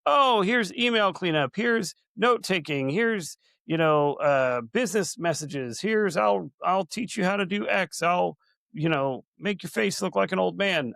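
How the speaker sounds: high-pitched imitation of pitching vendors; playful mock sales call; mock market hawker. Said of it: "everybody's yelling at you like".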